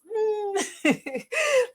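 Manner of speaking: high, squeezing "mmm" tipping into a giggle; virtual hug hum. Shown as a demonstration. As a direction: hum a big hug, then laugh. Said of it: "the hugs are like this".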